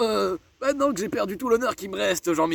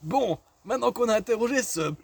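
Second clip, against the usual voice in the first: usual speaking voice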